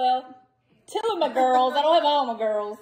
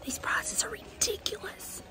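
whisper; 8 semitones higher, 12 dB quieter than usual. Real, not acted. Whispered aside in store.

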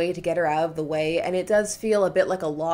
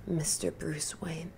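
faint, breathless whisper; fading away, barely voiced; dying whisper; 3 semitones lower, 12 dB quieter, slower than usual. Dying woman's last words.

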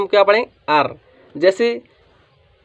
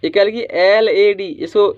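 ordinary delivery

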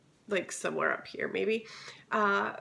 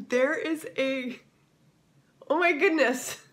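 sudden startled exclamation; surprised; 6 semitones higher, 5 dB louder than usual. Real, not acted. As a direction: caught off guard, blurt it. Spots bag flying in sky.

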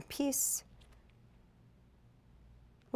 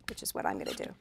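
unvoiced, hushed little aside; whispered aside; 13 semitones lower, 10 dB quieter, much faster than usual. Real, not acted. Crafter whispers her own choice to viewers, hushed. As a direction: drop to a whisper as if telling a secret.

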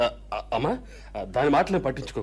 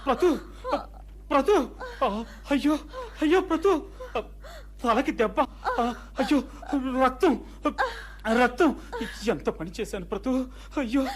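higher, shaken anguished cries; distressed crying; 9 semitones higher, 4 dB quieter than usual. Acted. Husband over his bleeding wife: anguished tearful cries. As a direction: stricken with guilt, near tears.

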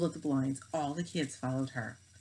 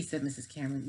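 usual speaking voice

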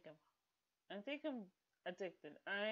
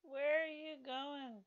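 surprised; 7 semitones higher, 5 dB louder, slower than usual. Real, not acted. Surprised at Sim leaving.

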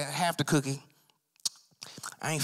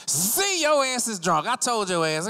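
high, loud pounce; triumphant accusation; 10 semitones higher, 4 dB louder than usual. Acted. Officer catches the drunk suspect, triumphant accusation. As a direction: burst out high as if you caught him out.

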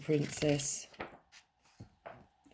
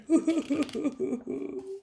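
high, quick fake boo-hoo; cartoon child's sobbing; mock crying; 10 semitones higher than usual. Princess has banged her knee, mock crying.

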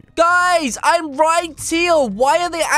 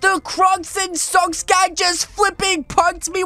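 ordinary talk

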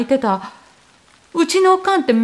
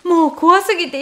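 plain reading voice

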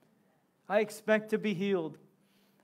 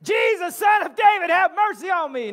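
an even louder, higher cry for mercy; acted desperate shout, even louder; 8 semitones higher, 8 dB louder than usual. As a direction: push it past the last shout. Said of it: "he shouted even louder".

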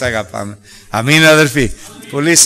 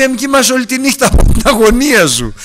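voiced king; 9 semitones higher than usual. Preacher voices anxious king.

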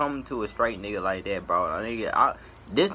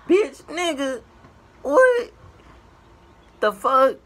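high, loud disbelieving yell; outraged shout; 13 semitones higher, 7 dB louder, slower than usual. Real, not acted. Reacting to a guy's flirty DM, high outraged yell. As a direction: shriek it in outrage. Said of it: "outraged reaction to the DM".

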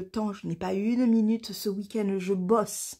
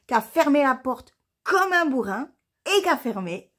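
loud, higher-pitched griping; exasperated rant; 5 semitones higher, 5 dB louder than usual. Real, not acted. Vlogger griping about whoever jammed the letterbox, exasperated. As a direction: punch it out in exasperation. Ranting about the careless delivery person.

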